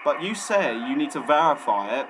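ordinary talk

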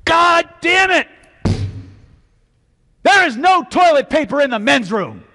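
furious high yell about the toilet paper; high-pitched enraged roar; angry shout. Retold or imitated. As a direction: storm in bellowing with rage. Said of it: "comes storming out".

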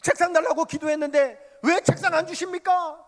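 high, loud indignant protest; a preacher protesting his unanswered prayer to God, loud; complaining to God. Retold or imitated. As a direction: demand an answer from God, aggrieved. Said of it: "또 하나님 앞에 불평하는 거예요".

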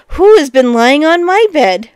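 lightly voiced middle bear, calling out; middling-sized voice. A narrator's reading. Middle bear seeing rumpled bed, middling-sized voice. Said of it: "cried out in a middling-sized voice".

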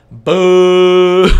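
long, loud jeering boo. Real, not acted. Booing the old Despacito meme, loud. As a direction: drown him out with a boo.

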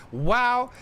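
high, startled wow; a sudden disbelieving wow; real shock at the wife; surprised wow. Real, not acted. Reader reacts to the wife.

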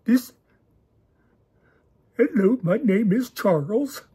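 put-on clumsy Indian accent intro, slowed down; bad Indian accent. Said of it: "an obvious Indian accent".

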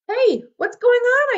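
high, loud and drawn-out yell. A narrator's reading. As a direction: yell it up at the silent trees. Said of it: "I yelled".

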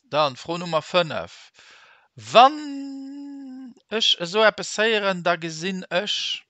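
high, slow, loud reading of the question. Shown as a demonstration. Teacher reading question five aloud, high and slow. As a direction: announce question five to the class.

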